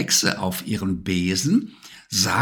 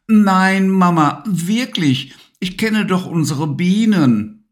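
put-on high, louder, indignant child; insist, a bit cross; annoyed child; 9 semitones higher, 5 dB louder than usual. A narrator's reading. Levi insisting to his mum about the bees, annoyed. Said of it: "Levi war etwas ungehalten".

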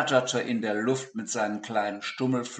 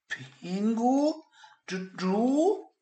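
stammering; 12 semitones higher, 4 dB louder, much slower than usual. Acted. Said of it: "stammelte Peter ungläubig".

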